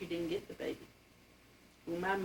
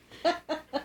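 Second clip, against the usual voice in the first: laugh; 10 semitones higher, 5 dB louder than usual. Real, not acted. Laughing about strict father.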